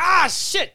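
sudden high pained yelp. Retold or imitated. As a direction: yelp in sharp pain. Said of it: "He was like".

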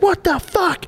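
shocked yelp. Retold or imitated. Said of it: "And he's like".

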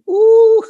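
loud, very high held "ooh"; delighted ooh. Retold or imitated. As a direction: coo in great wonder. Retold delight at a caterpillar.

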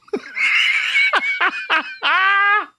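high squealing burst of laughter; shrieking laugh. Real, not acted. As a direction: howl with laughter. Reacting to silly name.

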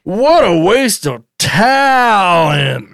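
slow, dragged-out disdain; mock disgusted dad. Father sneering at his son in a slow, mock-disgusted voice. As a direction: sigh it out like a disappointed father.

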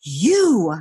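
mock commanding, singling someone out; a hint of a big, forceful presence; imposing lady. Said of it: "huge, kind of imposing lady".

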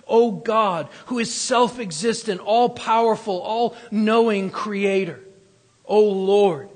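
loud, ringing praise to God; preacher praying at full voice; fervent prayer. Acted. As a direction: lift it up as a fervent cry.

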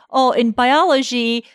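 ordinary delivery

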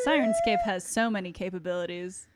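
hushed whisper; breathy secret whisper. Pretend. Whispered ad-style plug.

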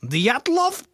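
slow, loud Borat-style Russian accent. Shown as a demonstration. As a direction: drawl it like Borat. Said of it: "That's Borat".

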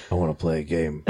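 low, soft sinister game voice; creepy villain voice. Retold or imitated. The creepy guy taunting at night, low and soft. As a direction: murmur it like a horror villain.

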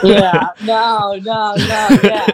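laughing through the words; chuckling agreement, amused; giggling yeah no no no. Real, not acted. Joking about Skywalkers and hallways.

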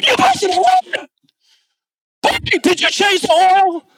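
disbelief, like a father hearing bad news; shocked dad. Father hears his son wrecked the engine, in a high, incredulous voice. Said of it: "I said".

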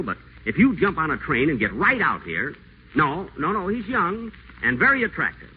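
high, gushing sweet talk; butter her up on the phone; honeyed phone charm. Acted. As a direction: pitch the voice up and coo over the phone line. Whiteside sweet-talks Lorraine on phone.

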